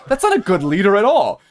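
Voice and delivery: loud, high-pitched disbelief; incredulous objection. Real, not acted. Friend ridiculing Boehner's leadership logic, real incredulous outburst. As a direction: scoff loudly with the pitch shooting up.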